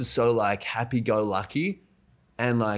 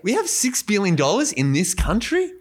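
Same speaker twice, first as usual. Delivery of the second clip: high-pitched incredulous question; imitated astonishment, pitched well up; mock disbelief; 8 semitones higher than usual. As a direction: act out amazement at a huge sum. Acting out disbelief at the economy's size.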